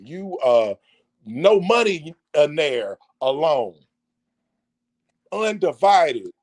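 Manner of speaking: ordinary talk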